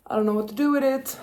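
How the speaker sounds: ordinary delivery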